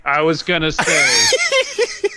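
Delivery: high squeal of laughter through the words; laughing. Real, not acted. Laughing at a friend's joke, squealing through the words. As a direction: crack up while trying to talk. Bursts out laughing mid-sentence.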